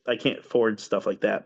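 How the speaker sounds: ordinary delivery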